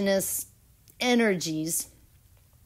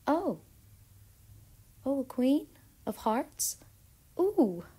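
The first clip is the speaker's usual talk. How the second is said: soft surprise; 4 semitones higher, 7 dB quieter than usual. Retold or imitated. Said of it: "I'm like".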